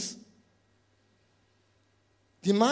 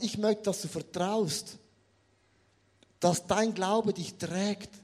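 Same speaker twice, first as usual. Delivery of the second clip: soft, slow reassurance; gentle God voice; 2 semitones lower, 5 dB quieter, slower than usual. Acted. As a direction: speak it tenderly as God. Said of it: "Gott sagt". (leise, langsame, tröstende Stimme Gottes)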